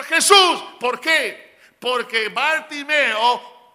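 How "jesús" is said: un solo grito alto y fuerte; grito insistente. Retold or imitated. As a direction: vuelve a gritar el nombre, más fuerte. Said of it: "Y él seguía diciendo". (grito de súplica que no se rinde)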